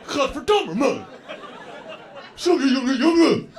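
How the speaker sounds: loud, high mock outburst in Dutch; angry Dutch cursing. Dutch cyclist cursing him off the bike path, angry. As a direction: imitate a furious cyclist berating someone.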